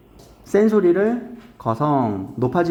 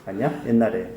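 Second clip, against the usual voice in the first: ordinary delivery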